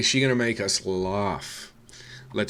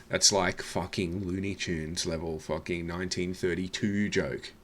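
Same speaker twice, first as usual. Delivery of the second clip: usual speaking voice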